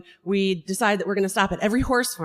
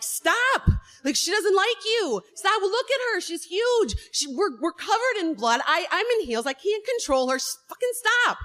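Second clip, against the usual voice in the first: high, frantic yelling at a cop; panicked plea to make him stop; 9 semitones higher than usual. Retold or imitated. To the cop running at the spooked horse, high frantic yelling.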